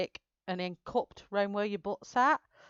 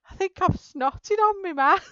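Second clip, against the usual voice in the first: higher quote; 10 semitones higher, 4 dB louder than usual. Retold or imitated. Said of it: "she went".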